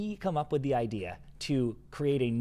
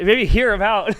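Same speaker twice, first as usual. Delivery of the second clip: breaking into laughter mid quote; words spilling out in a laugh; 7 semitones higher, 9 dB louder than usual. Real, not acted. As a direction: laugh through the last words, higher and louder.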